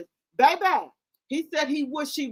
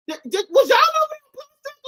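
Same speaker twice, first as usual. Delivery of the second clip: excited squeal; 13 semitones higher, 5 dB louder than usual. Real, not acted. Excited call to the audience.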